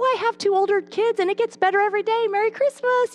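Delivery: high, quick, sing-out cheer; imagined over-cheerful retort; chirpy comeback. Retold or imitated. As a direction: fire back in a high, merry voice. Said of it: "I remember thinking, "Oh, if I was quicker".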